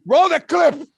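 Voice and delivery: high, loud Scarface command; loud, high mock gangster call for the clip; gangster bark. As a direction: bark it as Tony Montana. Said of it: "do it as Tony Montana".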